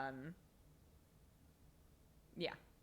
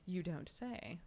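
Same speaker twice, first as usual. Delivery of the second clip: ordinary talk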